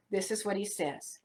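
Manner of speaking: ordinary talk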